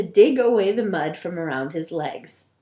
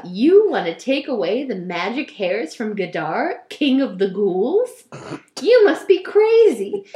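laughing ghoul; 5 dB louder than usual. Acted. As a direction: laugh the words at his face. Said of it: "The ghoul laughed".

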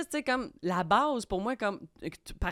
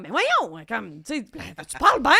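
Cathy protesting; 2 semitones higher, 7 dB louder than usual. Retold or imitated. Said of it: "puis elle était comme".

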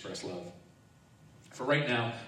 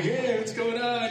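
higher, slow, laughing come-on; mock flirty greeting; 12 semitones higher, 6 dB louder than usual. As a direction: play it as a hammed-up flirt. Teasing seductive greeting, laughing.

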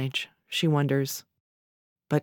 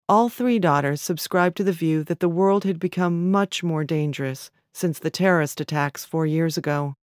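usual speaking voice

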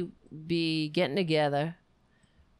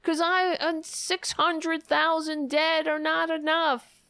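high, slow sarcastic protester voice; mock protester; 9 semitones higher, 3 dB louder, slower than usual. Streamer mocking a vaccine protester, high and hammed-up. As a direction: ham up a zealous anti-vax protester.

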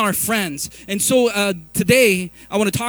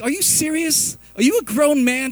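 incredulous; 6 semitones higher, 3 dB louder than usual. Retold or imitated. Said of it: "And like".

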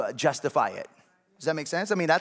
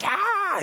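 high-pitched mock yell of "Ah!"; startled yell; 4 dB louder than usual. Son demonstrating his dislike of loud noises, startled yell. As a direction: act out recoiling from a loud noise. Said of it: "We both don't like loud noises".

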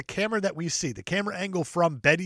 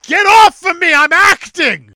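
high, very loud mock outburst; hammed-up yell; 12 semitones higher, 15 dB louder than usual. Crying actor fending off his co-star, hammed-up yell. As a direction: scream it like an overwrought actor.

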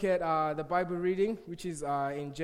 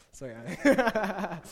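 laughing; 3 semitones higher, 7 dB louder, much slower than usual. Real, not acted. Teasing friend, then laughs.

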